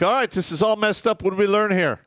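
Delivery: loud, high scolding sergeant; drill sergeant yell. Retold or imitated. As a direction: bark it at the troops. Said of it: "I'd be like".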